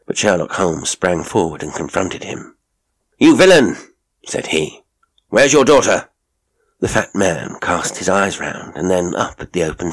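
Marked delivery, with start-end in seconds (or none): 3.2-3.8 loud, high denunciation; angry accusation. Acted. Holmes confronting the fat man at the door, angry accusation. 5.3-6.1 loud, high challenge; angry demand. Acted. Holmes demanding the missing daughter from her father, angry demand. Holmes confronts the burly man.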